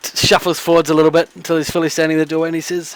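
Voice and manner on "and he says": ordinary talk, nothing marked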